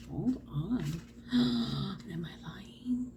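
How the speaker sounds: slow, hushed whisper after a gasp; whispered self-doubt. Real, not acted. Reader checking if she told viewers a lie, whispered self-doubt. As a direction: whisper it slowly to yourself.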